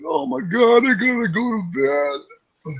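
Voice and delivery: high, slow, dragged-out mock weariness; sleepy person impression. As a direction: whine like someone ready to drop asleep. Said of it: "It's like".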